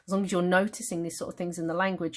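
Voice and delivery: ordinary talk, nothing marked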